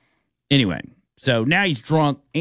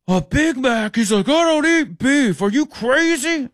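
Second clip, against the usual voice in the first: high, loud, slow mock Indian-accented outrage; outraged Indian accent; 14 semitones higher, 4 dB louder than usual. Passenger offended by beef offer, outraged Indian accent. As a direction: bristle at the Big Mac in an Indian accent. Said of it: "throws a temper tantrum".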